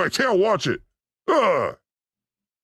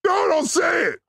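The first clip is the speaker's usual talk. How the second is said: alarmed shout; 12 semitones higher than usual. Real, not acted. Stopping caller saying name.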